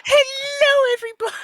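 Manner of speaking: high-pitched, loud YouTuber greeting; clickbait video narrator. Pretend. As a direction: squeal it like a hyped YouTuber. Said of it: "the YouTuber voice".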